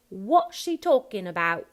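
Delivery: loud Cockney "about" with no t; WHAT and ABOUT cut before the t; dropped final T. Shown as a demonstration. Coach voicing Horace's line, dropped final T. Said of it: "just a no final T there".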